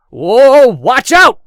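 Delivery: high, very loud alarmed shout. A narrator's reading. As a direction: yell a warning in panic. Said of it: "The man shouted in Andrews' face".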